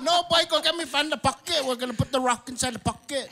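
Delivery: put-on high, insistent Guam-accented uncle; Uncle Kiko. Uncle explaining the errand to nephew, high insistent Guam accent. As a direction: imitate an exasperated uncle with a thick Guam accent. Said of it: "And he said".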